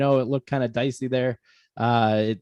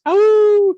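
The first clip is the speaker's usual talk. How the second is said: high, loud howling "ow!"; wolf howl cry; 8 dB louder than usual. Acted. Howl after show name.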